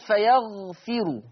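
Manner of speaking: "فيغفر" ending in ru, ghayn lingering; vowelled raa. Shown as a demonstration. Teacher giving the word before Warsh's change, "فيغفر" ending in ru. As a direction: draw out "فيغفر" and close it on a short u. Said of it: "يقرأ ورش بسكون الراء".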